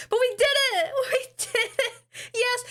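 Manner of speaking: high, giddy, laughing victory cry; excited cheer. Real, not acted. Streamer to chat celebrating the answer, giddy high cheer. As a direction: cheer it like you won. Still celebrating the solved problem.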